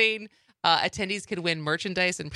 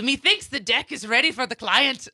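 pirate voice; 4 semitones higher, 4 dB louder than usual. Shown as a demonstration. Demoing pirate speak.

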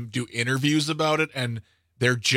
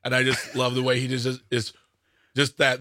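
ordinary talk

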